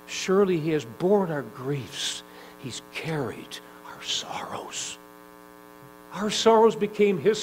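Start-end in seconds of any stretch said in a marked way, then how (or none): 2.6-5.0 slow reverent whisper. Acted. Preacher whispering the scripture to the congregation. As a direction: breathe the words, barely voiced.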